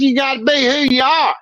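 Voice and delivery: ordinary talk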